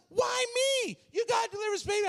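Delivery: high, frantic outcry; acted panicked shouting. Pastor told to deliver baby, high panicked shouting. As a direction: shout in comic desperation.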